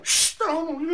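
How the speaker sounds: high shush from the neighbours. Retold or imitated. Neighbours shush the lion, high sharp shush. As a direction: hush it up high.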